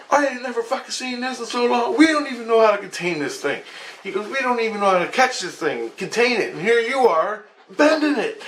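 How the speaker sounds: high, frantic disbelief, retold; freaking out. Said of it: "he was freaking out".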